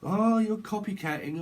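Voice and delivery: high, slow, sneering taunt; drawn-out mocking whine; mocking bully. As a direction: mimic a playground jeer. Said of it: "slagging people off".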